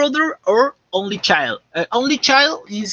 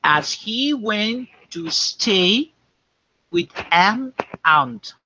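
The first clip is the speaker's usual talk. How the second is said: slow dictation; slower than usual. Shown as a demonstration. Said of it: "I'm going to repeat two times".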